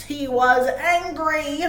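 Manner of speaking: ordinary delivery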